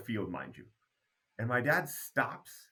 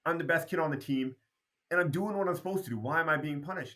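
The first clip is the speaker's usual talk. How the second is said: retold kid pleading his case, higher; indignant protest; 6 semitones higher than usual. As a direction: argue back at dad, pitched up and aggrieved. Child protesting punishment to his dad.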